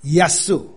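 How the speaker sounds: 耶稣 sounded out as "Ya-su". Shown as a demonstration. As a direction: demonstrate 耶稣 starting with a Y glide, "Ya-SU". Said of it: "应该是念I的音".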